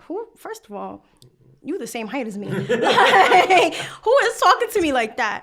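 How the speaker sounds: laughing, rising indignation; who does he think he is; indignant retort. Retold or imitated. Student to Spike Lee, laughing indignant retort. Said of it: "I look at him like".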